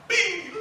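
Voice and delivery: high, loud comic "¡Ping!"; hit sound effect. Retold or imitated. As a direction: make the clang of the blow. Sound of a flag hitting the pastor. (efecto de golpe cómico)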